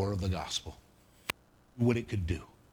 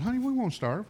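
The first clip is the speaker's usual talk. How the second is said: higher, amused, gently laughing; laughing reassurance; 11 semitones higher than usual. Retold or imitated. Dad reassuring his crying daughter, laughing lightly. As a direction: chuckle it off to calm her. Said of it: "I start laughing and said".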